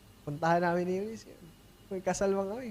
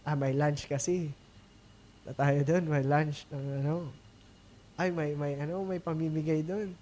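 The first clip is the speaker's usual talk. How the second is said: mock lunch excuse; 5 semitones lower, 5 dB quieter than usual. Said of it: "ano kayong reason natin".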